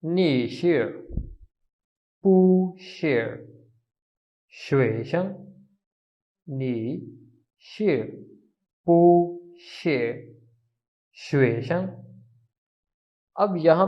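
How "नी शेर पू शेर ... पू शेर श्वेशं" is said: नी शेर पू शेर शुएशेंग in separate slow syllables; Mandarin drill. Shown as a demonstration. Teacher modelling नी शेर पू शेर शुएशेंग word by word. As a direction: sound out each Mandarin syllable with pauses. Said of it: "इससे हम sentence बनाते हैं।".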